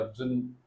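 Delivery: "जन" softly, as one short blended "zn"; sounds merged. Shown as a demonstration. Teacher drills the learner on merging, soft blended "जन". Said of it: "जा और ना को मिला दीजिए".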